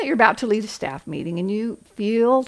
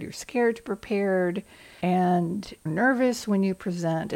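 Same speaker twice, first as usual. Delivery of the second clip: usual speaking voice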